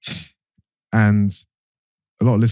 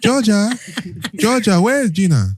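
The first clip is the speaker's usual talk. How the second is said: higher-pitched put-on Ghanaian accent; mock Ghanaian accent; 8 semitones higher, slower than usual. Host hamming up a Ghanaian accent for the sisters. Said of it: "the African accent that you did".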